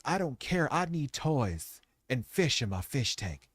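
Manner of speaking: mock pleading in a silly put-on voice; put-on begging sellout voice. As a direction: plead like a shameless sellout, hushed and rising. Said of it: "Why am I talking like this? Jeremy doesn't talk like this".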